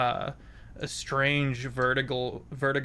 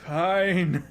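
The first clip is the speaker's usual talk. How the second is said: grudging fey voice; 6 semitones higher, 12 dB louder than usual. Acted. Wizen gives in, far loud.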